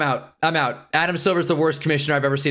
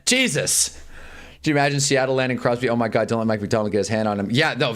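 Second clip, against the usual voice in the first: ordinary delivery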